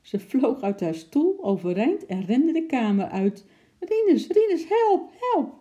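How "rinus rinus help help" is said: very high, loud, frantic calling; panicked cries for help. A narrator's reading. Wife flees the room from a chimp, panicked screaming.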